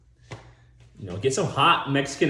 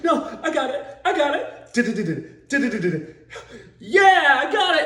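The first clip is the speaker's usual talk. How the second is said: much higher, laughing through it; overconfident driver; 5 dB louder than usual. Retold or imitated. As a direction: insist you've got it, cracking up. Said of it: "the guy's like".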